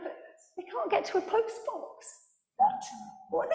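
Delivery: high-pitched, incredulous retort; as if the request were absurd; exasperated disbelief. Retold or imitated. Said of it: "I'm like".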